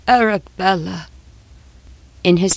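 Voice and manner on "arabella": grief trailing off on "Arabella"; fading wail. Acted. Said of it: "broke forth into a low wail".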